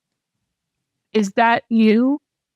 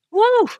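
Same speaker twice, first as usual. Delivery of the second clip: mock whoop; 13 semitones higher than usual. Texting friend about the pain.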